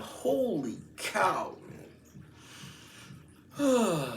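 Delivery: high, long drawn-out disbelief; astonished. Retold or imitated. Customer reacts to the agent's odd word, drawn-out astonishment. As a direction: stretch each word in amazement, high and slow.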